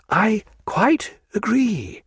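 a prim civil servant choosing his words; measured bureaucrat explaining the rules; Solmes the official. A narrator's reading. Solmes placating the captain.

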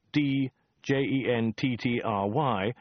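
slow, each letter read out on its own; spelled out letter by letter. Acted. Narrator spelling a sign letter by letter.

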